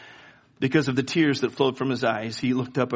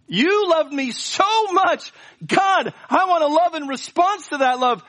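much higher, louder, slow pleading prayer; fervent prayer; 12 semitones higher, 4 dB louder than usual. Shown as a demonstration. Preacher voicing a returning believer's prayer, fervent pleading. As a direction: cry out to God at full force. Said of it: "go back and say".

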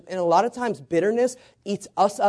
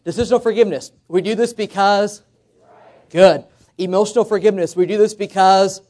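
louder, fired-up call; preacher calling for a loud response; like we mean it; 3 semitones higher, 7 dB louder than usual. Shown as a demonstration. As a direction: rouse the room to shout it back. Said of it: "say it like we mean it".

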